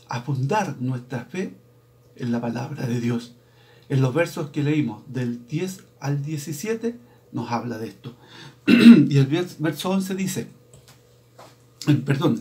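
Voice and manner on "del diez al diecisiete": usual speaking voice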